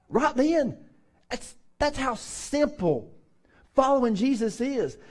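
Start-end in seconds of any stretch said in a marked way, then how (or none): none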